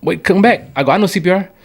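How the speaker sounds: high, urgent cry; urgent alarmed call. Retold or imitated. Worker calling out to idle managers, urgent high cry. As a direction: stop them, there is no time. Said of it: "I said".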